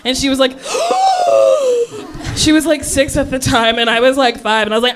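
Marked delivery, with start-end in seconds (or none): 0.6-2.3 high shocked gasp. Retold or imitated. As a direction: gasp in horror. Sister shocked about Santa.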